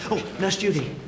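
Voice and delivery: normal talking voice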